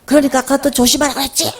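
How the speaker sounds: mock exasperated telling-off; sudden angry scolding. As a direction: scold as if blowing up over nothing. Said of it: "괜히 화를 벌컥 내요".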